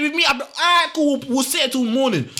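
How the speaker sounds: high-pitched, stubborn comeback; hammed-up, high show of defiance; defiant retort. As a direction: dig in like a rebellious kid. Acting out defiant answer to mum.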